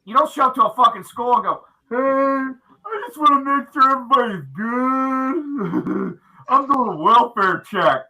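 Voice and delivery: loud drill sergeant bark; Sergeant Doofy. Acted. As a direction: yell it like a cartoon drill sergeant. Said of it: "Why do you sound like Sergeant Doofy?".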